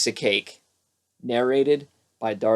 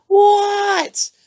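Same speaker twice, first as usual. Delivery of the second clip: little frog's high reply; 4 dB louder than usual. Acted. Froggy answers.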